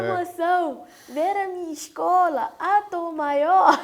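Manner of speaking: high, loud, drawn-out showgirl squeal; hammed-up overcome fan, stretching the words; mock paniquete gushing. Lady to reporter about her school, mock gushing. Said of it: "Assim, igual paniquete".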